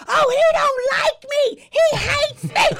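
high, slow, shouted wail; loud neighbor impression. Mocking the neighbor whining that the dog hates her, in a high slow shouting wail. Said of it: "That was a good impression".

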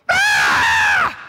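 loud, high yell of alarm; short, loud shriek; panicked scream. Retold or imitated. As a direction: scream out in sudden terror. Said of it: "I go".

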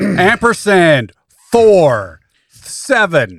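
very slow, drawn-out announcing; big, spaced-out proclamation; mock password announcement. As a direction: call it out word by word. Mocks reading password out loud.

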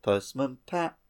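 ordinary delivery

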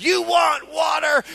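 Moses snapping, loud and slow; hammed-up furious Moses; angry shout. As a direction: shout it at the people in anger. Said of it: "Moses gets mad".

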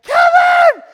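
mother's panicked shriek of Kevin; frantic scream. Retold or imitated. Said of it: "she's like".

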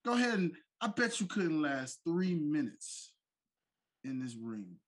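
taunting heel promo; wrestling promo. Pretend. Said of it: "bring this in segment style".

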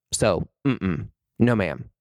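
normal talking voice

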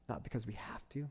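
ordinary talk

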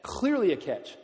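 usual speaking voice

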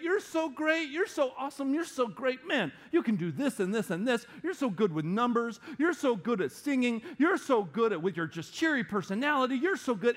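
high-pitched gushing compliments; gushing flattery. Acted. Admirers telling someone they're so great, gushing. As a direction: heap on praise, mock-admiring and high. Voicing people heaping on compliments.

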